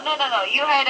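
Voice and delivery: normal talking voice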